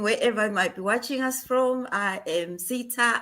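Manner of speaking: normal talking voice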